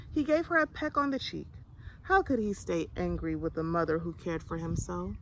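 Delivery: ordinary delivery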